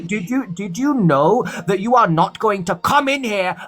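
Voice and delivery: high-pitched, slow, deliberate telling-off; stern scolding. Real, not acted. Host scolds and dismisses a caller.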